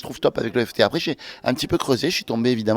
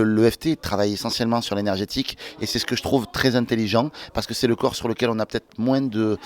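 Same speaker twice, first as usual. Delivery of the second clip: normal talking voice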